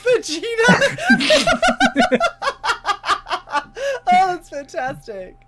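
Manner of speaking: high laughing squeal through words; laughing hard. Real, not acted. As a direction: speak while cracking up. Laughing at DBZ joke.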